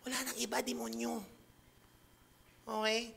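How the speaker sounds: usual speaking voice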